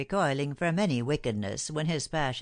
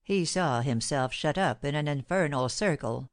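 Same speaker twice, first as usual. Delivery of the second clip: plain reading voice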